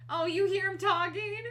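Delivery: high and loud delighted question; telling viewers about the talking cat, excited. Real, not acted. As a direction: point out the meowing with glee, loud and high. Cat meowing.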